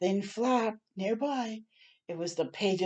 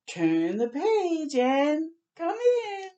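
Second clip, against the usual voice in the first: slow high invitation; 10 semitones higher, 3 dB louder, slower than usual. Acted. Fish invite.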